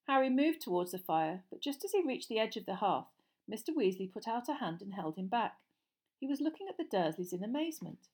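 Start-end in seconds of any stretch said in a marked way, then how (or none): none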